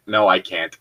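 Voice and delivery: usual speaking voice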